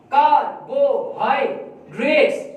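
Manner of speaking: Carbohydrates said slowly in parts; slow, careful syllable reading. Shown as a demonstration. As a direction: put carbohydrates together slowly. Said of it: "syllables के अंदर करवाएंगे".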